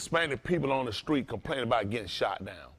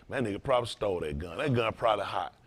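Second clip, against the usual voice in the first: lower, softer, fast muttered aside; imitating a suspicious brother; 7 semitones lower, 6 dB quieter than usual. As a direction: play the guy who assumes the worst, dismissive.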